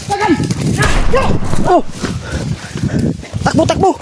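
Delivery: breathless shouts on the run; shouting while running. Real, not acted. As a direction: yell while fleeing.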